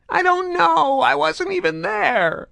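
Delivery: high, slow whining plea; mewling whine. A narrator's reading. Grotto whines to his torturer, high and slow. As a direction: whimper it out. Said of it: "high-pitched, mewling whine".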